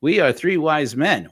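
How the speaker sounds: a hint of stately wise man. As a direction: announce yourselves like a solemn visiting wise man. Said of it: "and they're like".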